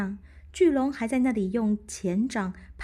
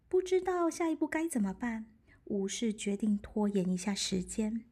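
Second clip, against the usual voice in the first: ordinary delivery, nothing marked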